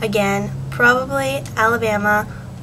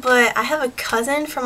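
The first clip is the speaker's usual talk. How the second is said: usual speaking voice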